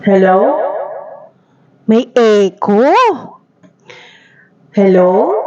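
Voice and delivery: ordinary delivery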